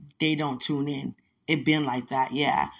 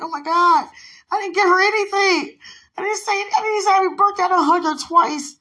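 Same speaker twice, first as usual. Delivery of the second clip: much higher and louder frantic wail; mock panic; 13 semitones higher, 9 dB louder than usual. Retelling panic at missing a friend's birthday, frantic. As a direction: panic over forgetting the birthday.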